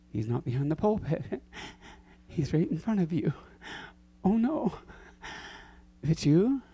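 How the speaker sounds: ordinary talk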